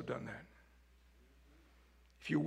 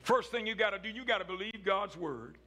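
ordinary delivery

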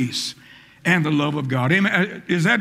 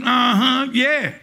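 mock self-righteous gloat; a touch of smug told-you-so; smug attitude; 6 semitones higher than usual. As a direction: savor the payback smugly. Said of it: "got this attitude".

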